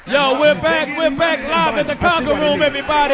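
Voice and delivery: hyped return from the break; radio host welcome. Real, not acted. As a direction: pump up the crowd as host.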